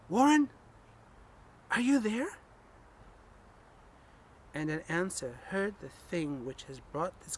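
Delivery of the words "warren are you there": higher, louder anxious call. Acted. Narrator calling down the receiver to Warren, anxious call. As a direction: call down into the dark, hoping for an answer. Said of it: "Again I called down".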